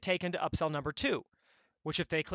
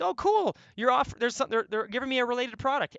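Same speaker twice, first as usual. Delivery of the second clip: mock delight, much higher and louder; delighted customer; 7 semitones higher, 4 dB louder than usual. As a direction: pretend to be happily surprised by the offer. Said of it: "They just think".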